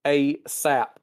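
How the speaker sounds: ordinary talk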